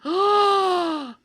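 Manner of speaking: high, loud, shocked outrage. Real, not acted. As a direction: gasp and demand in disbelief. Shocked at child peeing.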